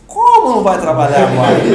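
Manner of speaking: loud, laughing shock; laughing disbelief. Retold or imitated. Husband laughing in disbelief at his wife's news. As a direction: blurt it out through a laugh. Shocked reply to wife quitting.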